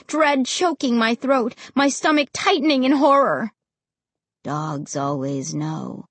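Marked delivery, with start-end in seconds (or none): 4.4-6.0 deep, slow, flat voice; low, flat tone. A narrator's reading. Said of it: "Ray said in a low, flat tone".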